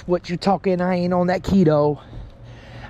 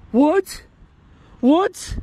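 high rising disbelief; baffled, high-pitched questioning; incredulous; 5 semitones higher than usual. Real, not acted. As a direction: pitch each word up in shock. Disbelief at the find.

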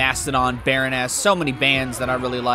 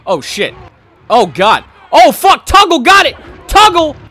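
very loud, very high panicked shouting; frantic yell; 13 semitones higher, 13 dB louder than usual. Real, not acted. Gamer screaming as the opponent runs away, very loud and high. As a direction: scream at the screen as the play breaks. Opponent breaks a big play.

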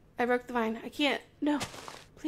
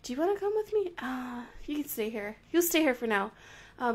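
usual speaking voice

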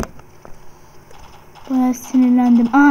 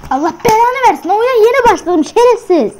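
furious yelling; 6 semitones higher, 4 dB louder than usual. Real, not acted. Gamer raging at opponent.

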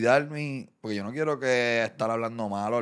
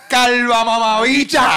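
high, loud, slowed yell; mock insult shout; 10 semitones higher, 13 dB louder, slower than usual. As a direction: hurl it like a commenter, hammed up. Imita insultos de los comentarios. (insulto gritado en broma)

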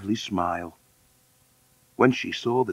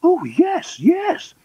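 very high, loud matron voice; eager high-pitched matron; orphanage matron; 5 dB louder, slower than usual. Acted. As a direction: agree loudly, way up in pitch. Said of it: "said the orphanage matron".

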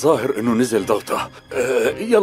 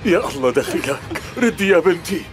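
higher anxious plea; pleading; 6 semitones higher than usual. Acted. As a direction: beg her to answer. Pleads for an answer.

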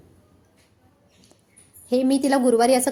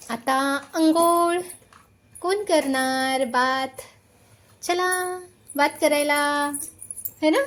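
drawn-out sing-song; 3 semitones higher, much slower than usual. Real, not acted. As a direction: stretch "अंघोळ" out playfully. Asking toddler about bath.

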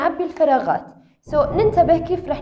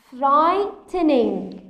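slow modelling; much slower than usual. Shown as a demonstration. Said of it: "teacher models word pronunciation".

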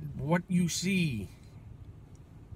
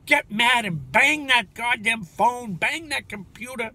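louder, higher, slow hammed-up rant; half-joking fired-up shout; mock angry urging; 5 semitones higher, 7 dB louder, slower than usual. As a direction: egg the viewer on to smash the phone. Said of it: "If I make you pissed off".

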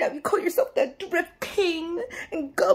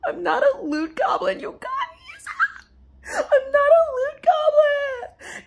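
crying protest; 11 semitones higher than usual. Acted. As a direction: wail it out like a wounded goblin. Denying he is a goblin.

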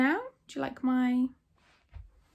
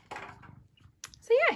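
cat voice. Real, not acted. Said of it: "talks to their cat in a cat voice".

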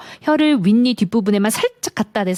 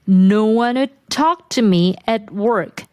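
slow, stress on "talk" and "work"; stress demo; much slower than usual. Shown as a demonstration. Teacher modelling the stressed words of the line. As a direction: hit the key words slowly. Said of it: "강세받는 부분".

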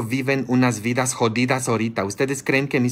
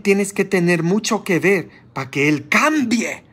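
scolding sermon; 7 semitones higher than usual. Real, not acted. Preacher scolding the congregation.

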